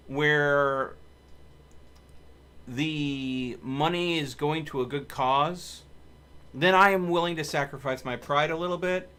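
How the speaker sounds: ordinary delivery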